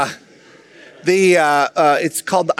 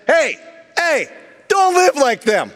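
higher, slow stern warning as Paul; 8 semitones higher, slower than usual. Retold or imitated. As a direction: wag a finger at the Gentiles, slowly. Paul voiced warning Gentiles.

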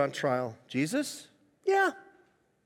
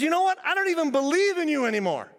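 high, raised-voice renunciation; retold screaming at God; 10 semitones higher, 3 dB louder, faster than usual. Retelling a friend renouncing God, mock screaming. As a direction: throw it at God in a mock yell. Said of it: "I'm screaming at God".

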